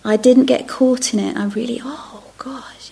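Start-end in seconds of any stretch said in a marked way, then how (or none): 1.8-2.9 breathy whispered aside, almost no voice; whispered reaction. Retold or imitated. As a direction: whisper it to yourself. Inner reaction quoted, whispered.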